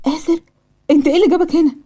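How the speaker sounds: high-pitched surprised question; acted disbelief at seeing him; astonished. A narrator's reading. Nurse finding her patient at the hospital, astonished. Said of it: "وبصت له باستغراب".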